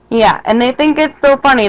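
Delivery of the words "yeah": ordinary talk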